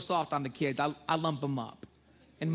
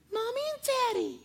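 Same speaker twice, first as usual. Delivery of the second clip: put-on much higher, sweet small-child reply; little girl voice. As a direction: answer like a tiny innocent daughter.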